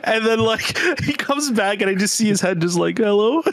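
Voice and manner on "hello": a lightly voiced dog, bright questioning rise; mock dog voice. Dog peeking onto the screen asks hello, lightly voiced. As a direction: play the dog peeking in, calling out. Voicing the dog's head appearing.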